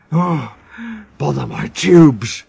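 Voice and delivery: puffed-out grumble; mildly winded complaint; panting. Acted. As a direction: exclaim between heavy breaths. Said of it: "he panted".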